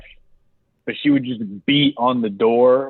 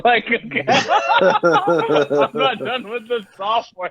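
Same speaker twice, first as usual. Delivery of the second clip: laughing through the words; slow, laughing delivery; 10 semitones higher, much slower than usual. Real, not acted. Cracking up while joking to his friend, laughing.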